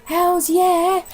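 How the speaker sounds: high, bright exclamation of delight; sudden burst of excitement; excited cheer. Real, not acted. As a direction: cheer it like a win. Excited exclamation over DVD.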